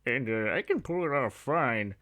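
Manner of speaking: Plankton talking voice with a put-on rasp. Shown as a demonstration. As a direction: talk like a tiny scheming villain.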